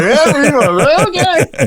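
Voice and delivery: high mocking voice; mock of co-host. Teasing co-host with a high mock voice. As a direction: squeak it like a nervous co-host. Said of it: "You're like".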